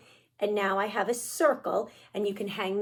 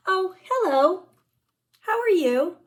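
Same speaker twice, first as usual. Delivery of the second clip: high, loud cheery pigeon; bright bird greeting, pitched up; storybook pigeon saying hello; 7 semitones higher, 6 dB louder than usual. Acted. Teacher voicing pigeon in book.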